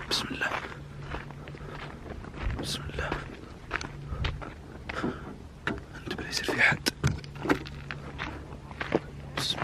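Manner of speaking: hushed whisper, afraid of being heard; nervous whisper. Real, not acted. Walking through a dark graveyard to his friend, whispering. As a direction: whisper it close so nothing hears you.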